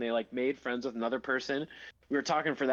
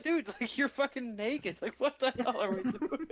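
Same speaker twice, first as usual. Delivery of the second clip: high, disbelieving, imitating; mock shock; 10 semitones higher than usual. Roommates react to Jeremy showing up in boxers, high mock disbelief. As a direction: throw it up high like you can't believe it.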